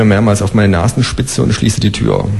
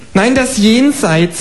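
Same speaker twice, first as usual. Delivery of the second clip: high, slow deadpan kangaroo; dry kangaroo; 13 semitones higher, much slower than usual. Acted. Kangaroo mocking a silly question, high and slow. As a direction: drag it out, sarcastic. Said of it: "sagt es".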